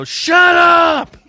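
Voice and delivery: sharp loud yell; bailiff yelling. Retold or imitated. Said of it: "he'd just come out and yell and go".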